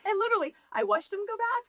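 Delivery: high, fast words broken by real laughter; laughing. Real, not acted. To the host, laughing as her husband locks her out. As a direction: laugh your way through the memory.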